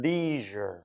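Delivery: leisure as "LEE-zher"; long ee and soft zh in leisure; American pronunciation. Shown as a demonstration. Teacher showing students American leisure as "LEE-zher". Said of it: "미국식 발음을".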